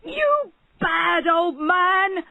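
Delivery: very slow, loud, high furious shout; furious shouting barber; angry shout. A narrator's reading. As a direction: bellow it at the old man. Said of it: "shouted the barber".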